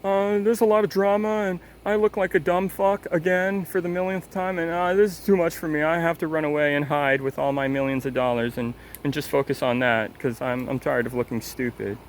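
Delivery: whiny put-on voice, high at first, sinking back to normal; mocking Critical. Mocking Critical as he quits the drama, whiny and high at first. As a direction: play him as a sorry crybaby running off, fading into the own voice. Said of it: "And now look at Critical".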